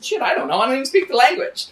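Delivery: squeaky high mock disbelief on the last part; flustered disbelief. Retold reply, caught out.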